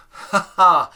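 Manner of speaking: high, loud "ha ha"; loud laugh. Real, not acted. Teacher amused by own mistake, loud laugh. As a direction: burst out laughing at your own slip.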